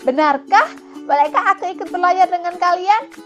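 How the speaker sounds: high, loud eager asking; excited, wishful request; hopeful monkey. Acted. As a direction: beg to come along, pitch climbing. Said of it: "ucap kera penuh harap".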